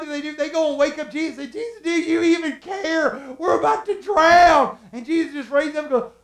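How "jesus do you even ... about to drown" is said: high, loud frantic plea; panicking. Acted. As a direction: cry out as a terrified disciple waking Jesus. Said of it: "They're freaking out and panicking".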